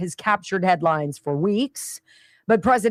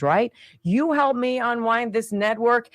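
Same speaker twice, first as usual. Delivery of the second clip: normal talking voice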